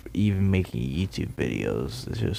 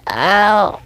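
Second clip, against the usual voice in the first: yelp; 12 semitones higher, 7 dB louder than usual. Acted. Sudden yelp mid-story.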